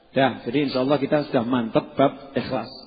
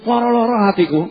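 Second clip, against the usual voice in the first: ordinary delivery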